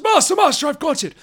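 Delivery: high-pitched excited minion voice; servant's cry. Acted. Mora runs in with the staff, high urgent servant cry. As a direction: squeal it up to the master.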